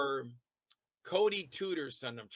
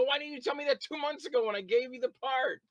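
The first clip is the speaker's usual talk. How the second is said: higher, incredulous scolding; exasperated demand; 10 semitones higher than usual. Retold or imitated. Director scolding the actor who never said he had no car, exasperated. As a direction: demand an answer, pitched up and annoyed. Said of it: "I go".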